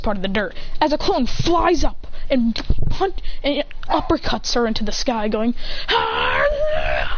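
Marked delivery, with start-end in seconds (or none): 5.9-7.2 long rough battle cry; uppercut yell. Acted. Clone uppercuts her into the sky, long straining yell. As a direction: strain through a drawn-out attack yell.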